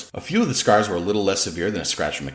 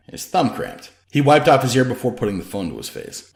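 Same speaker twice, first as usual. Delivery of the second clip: plain reading voice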